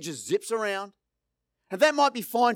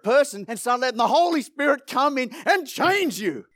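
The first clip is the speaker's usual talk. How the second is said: preaching that builds to a loud, higher-pitched peak; impassioned preaching; 4 semitones higher, 4 dB louder than usual. Real, not acted. Preacher urging the congregation toward change, impassioned and building. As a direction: drive each repeated phrase harder, like a rallying preacher.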